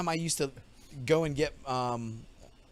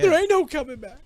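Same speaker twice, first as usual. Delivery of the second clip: mock villain quote; 11 semitones higher, 5 dB louder than usual. Echoing T-Bird quote.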